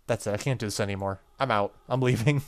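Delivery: scared loud I'm out; panicked quitting. Real, not acted. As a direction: blurt it out like you're bolting for the door. Spooked, quitting, loud and fast.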